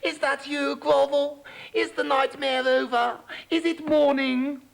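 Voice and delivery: high, drowsy little frog voice; a frog half asleep asking questions; sleepy frog. Acted. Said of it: "said Pipsqueak sleepily".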